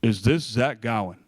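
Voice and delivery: imitated phone caller; caller's voice. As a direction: act out an official caller checking a name. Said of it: "I hear a voice".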